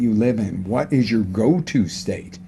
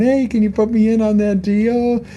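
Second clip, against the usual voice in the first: much higher, dreamy happy-go-lucky trader; a Wall Street trader asking into a deal, much higher and blissful; 11 semitones higher, 4 dB louder than usual. Pretend. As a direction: sound too cheerful for the trading floor. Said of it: "you're on Wall Street going".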